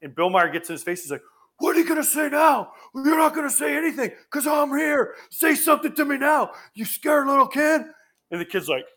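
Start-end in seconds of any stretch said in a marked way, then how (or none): 1.6-7.8 high, strained mock shout; imitating an enraged catcher; acted confrontation. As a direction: get in the kid's face and dare him. Catcher confronts kid in stands.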